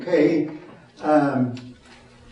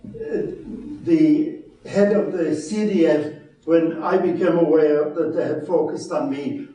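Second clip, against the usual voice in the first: ordinary talk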